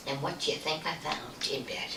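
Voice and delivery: breathy hushed build-up; suspense whisper. Real, not acted. Storyteller hooking listeners before the reveal, hushed whisper. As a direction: lean in and whisper the tease. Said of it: "storyteller builds suspense".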